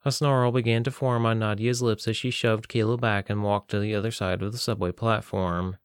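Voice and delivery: ordinary delivery, nothing marked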